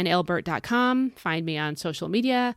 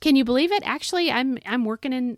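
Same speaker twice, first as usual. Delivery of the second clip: real excitement, pitched up; so excited; 6 semitones higher than usual. Real, not acted. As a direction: share happy news in a higher voice. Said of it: "I'm so excited".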